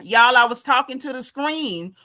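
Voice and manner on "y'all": normal talking voice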